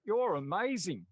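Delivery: high, loud, swooping praise; retold great enthusiasm. Bar worker praises a customer, imitated gushing enthusiasm. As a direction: gush it as the bar worker did. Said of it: "say that to me with great enthusiasm".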